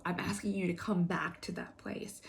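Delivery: soft intimate whisper. Real, not acted. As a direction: whisper it gently. Intimate plea within morning message.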